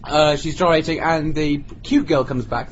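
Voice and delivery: ordinary delivery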